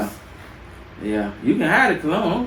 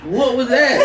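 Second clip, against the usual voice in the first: high, loud startled question; shocked laugh-through question; 6 semitones higher, 4 dB louder, slower than usual. Retold or imitated. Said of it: "I was like".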